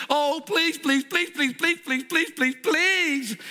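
high, pleading chant of please; whiny begging. Acted. Ben begs the manager for free coffee, high and whiny. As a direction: beg like a child for a treat.

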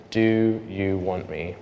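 slow, low, softened question; gentle divine voice. Retold or imitated. God asking him a third time, slow and soft. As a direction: ask it slowly and quietly. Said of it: "Third time".

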